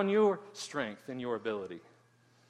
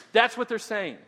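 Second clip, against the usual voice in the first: ordinary talk, nothing marked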